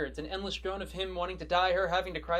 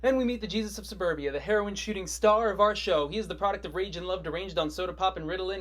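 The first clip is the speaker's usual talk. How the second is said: ordinary talk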